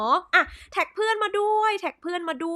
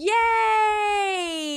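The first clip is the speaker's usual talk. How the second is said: high, loud drawn-out cheer; big celebratory whoop on a high pitch; 8 semitones higher, 5 dB louder than usual. Real, not acted. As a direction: cheer a long, loud yay. Host cheers as the show starts.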